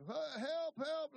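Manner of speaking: high, loud mock cry for help; acted hollering for help. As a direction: imitate a panicked man hollering. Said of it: "the veterinarian was hollering".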